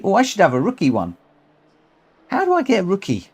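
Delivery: usual speaking voice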